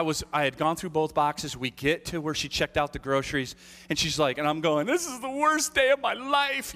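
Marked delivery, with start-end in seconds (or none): 4.9-6.7 mock childish despair; whiny child. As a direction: wail it like a worn-out little kid. Kid whining at grocery checkout.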